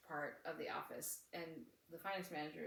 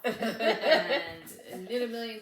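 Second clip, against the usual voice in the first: laughing; 2 semitones higher, 10 dB louder than usual. Retold or imitated. Joke resolution told laughing.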